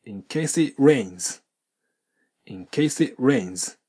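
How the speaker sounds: very slow, "case it" blended into one word; slow linked demo. Shown as a demonstration. Teacher modelling the phrase slowly for students to repeat. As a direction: draw "In case it rains" out slowly with the linking. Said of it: "と発音してみてください".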